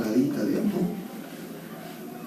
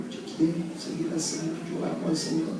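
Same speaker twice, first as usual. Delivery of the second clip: put-on much softer, hushed voice of a weeping young man; soft, broken plea; 9 dB quieter than usual. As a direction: say it quietly as a boy saying goodbye. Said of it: "los veo llorar". (susurro quebrado del muchacho que se despide)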